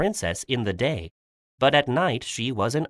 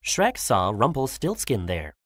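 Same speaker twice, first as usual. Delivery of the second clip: usual speaking voice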